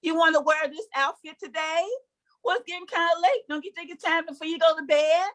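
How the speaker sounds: mock sweet parent asking kids; sweet modern parent. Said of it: "You're asking your kids things like".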